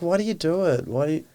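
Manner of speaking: high, pleading exasperation; why would you, frustrated; exasperated. Retold or imitated. To his partner over hate messages, exasperated and high.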